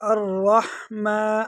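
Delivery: الرحمن with a full, heavy r; thick ra. Shown as a demonstration. Teacher showing students the heavy letter, thick ra in الرحمن. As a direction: slowly recite الرحمن, thickening the ra and drawing out the vowel. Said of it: "را کو موٹا کر کے پڑھیں گے".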